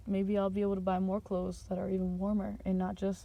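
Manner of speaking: ordinary talk, nothing marked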